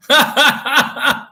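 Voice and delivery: high, loud laughing burst; amused laugh. Real, not acted. Streamer amused by chat comments, high laugh.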